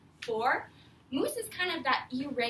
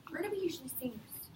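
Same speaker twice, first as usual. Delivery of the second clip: whispered, quick question; whispering; 3 semitones higher, 5 dB quieter, faster than usual. Real, not acted.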